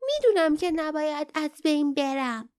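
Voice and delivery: high, confident little creature; self-confident. A narrator's reading. Young Kin answering Barda's worry, confidently. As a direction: declare it sure of yourself, pitched high. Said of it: "با اعتماد به نفس گفت".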